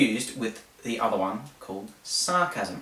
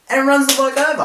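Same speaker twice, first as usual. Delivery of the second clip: much higher and louder burst; dramatic punchline; 14 semitones higher, 10 dB louder, faster than usual. Real, not acted. Telling friends the ambulance hit the bloke, loud burst. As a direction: hit the twist hard.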